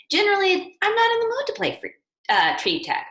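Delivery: hammed-up reluctance over tree tag; reluctant whine. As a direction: whine like you'd rather not play. Reluctance about family tree tag.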